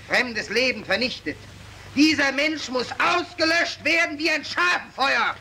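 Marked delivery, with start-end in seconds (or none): 1.9-5.3 each sentence higher, hammering home; rising demand. Acted. Prosecutor to the mob, rising fierce demand. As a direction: whip up the crowd.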